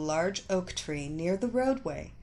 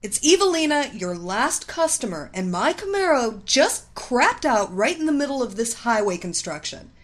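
loud, high-pitched angry tirade; irate customer laying down orders; furious demand; 4 semitones higher, 9 dB louder than usual. A narrator's reading. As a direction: berate the mechanic, building to a shouted order. Furious customer phones the mechanic.

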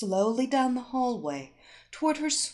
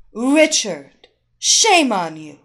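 loud teacher scolding; 11 dB louder than usual. Acted. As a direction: scold him like a fifth grade teacher.

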